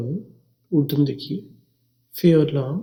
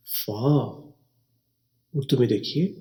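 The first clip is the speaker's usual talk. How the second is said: ordinary delivery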